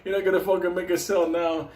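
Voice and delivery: high, laughing, taunting voice; laughing mock taunt from an app page that blocks your sale; mock app message. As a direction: sneer it like a smug trading app. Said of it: "fucking pages that say".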